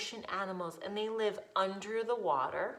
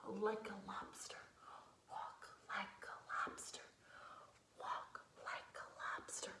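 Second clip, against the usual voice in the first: soft hushed whisper, much quieter; 17 dB quieter than usual. Acted. Teacher whispering to kids to walk like lobsters, hushed. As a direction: hush it like a secret game.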